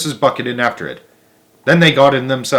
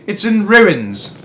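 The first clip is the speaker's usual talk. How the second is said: high, slow wailing lament; dismayed; 9 semitones higher, 3 dB louder, much slower than usual. A narrator's reading. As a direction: drag it out in dismay. Mr Bucket laments.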